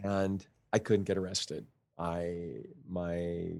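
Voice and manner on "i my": normal talking voice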